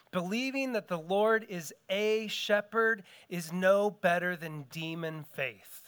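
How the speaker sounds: normal talking voice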